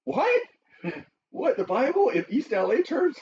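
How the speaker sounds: high-pitched incredulous question; mock astonishment. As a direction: act out a stunned listener's disbelief. Crowd's amazed reaction, acted out.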